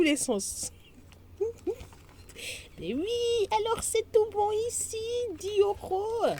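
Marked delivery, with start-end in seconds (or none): 2.8-6.3 much higher, chirpy; delighted. Real, not acted. Vlogger pleased everything adds up, high chirpy delight. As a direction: bubble with satisfaction.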